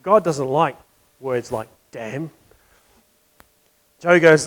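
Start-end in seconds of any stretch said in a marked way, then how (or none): 0.0-2.3 a hint of a prim, softer, lower minister voice; lightly voiced proper minister telling Joe off. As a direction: gently scold your friend like a proper clergyman. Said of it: "very proper".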